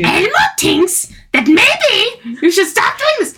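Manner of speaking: high, slow Elmo voice; sing-song high puppet voice. Retold or imitated. Friend repeats her Elmo impression for the group, high and slow. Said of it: "You do a good job".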